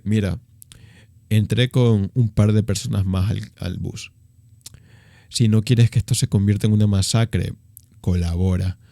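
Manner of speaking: low, hard gangster threat; menacing robber. Retold or imitated. (ladrón amenazando en el bus, voz grave y dura)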